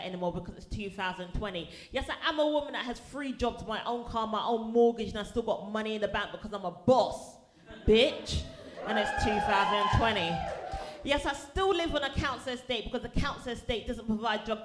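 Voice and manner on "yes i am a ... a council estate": building to a loud proud shout; rally it, louder each line; defiant boast. Acted. Defiant speech to stereotypers.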